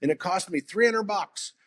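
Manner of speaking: mock old man. Retold old store owner quote.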